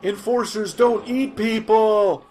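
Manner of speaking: high, loud, slow exclamation; exasperated shout. Acted. Reporter correcting a clueless rebel, exasperated shout. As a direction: hammer it out in disbelief.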